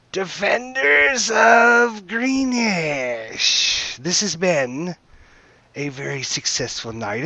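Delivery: high, loud, gloating challenge, very slow on the opening; half-dragon villain's hail. Acted. Half-dragon calling up to the keep's defenders, high and gloating. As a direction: taunt the defenders from below the walls. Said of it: "the half-dragon creature hails to the keep".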